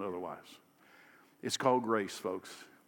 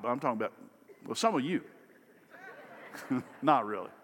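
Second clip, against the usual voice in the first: ordinary talk, nothing marked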